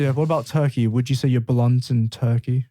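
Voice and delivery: ordinary talk, nothing marked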